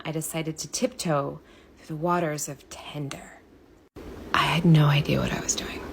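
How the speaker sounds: hushed, breathy storytelling; whispered confession to viewers; whispered aside. Acted. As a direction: let them in on a secret.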